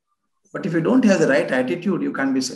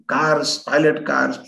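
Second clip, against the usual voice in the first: normal talking voice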